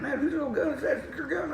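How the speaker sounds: high-pitched, curious buddy voice; Dana. Retold or imitated. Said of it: "He said".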